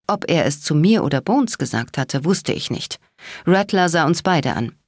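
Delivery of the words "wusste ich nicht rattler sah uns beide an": ordinary delivery, nothing marked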